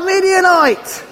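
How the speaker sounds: lightly shouted demo. Shown as a demonstration. Farmer teaches children the warning call, mild shout.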